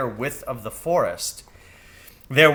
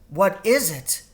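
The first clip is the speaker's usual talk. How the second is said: in wonder; 7 semitones higher than usual. A narrator's reading. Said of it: "she asked in wonder".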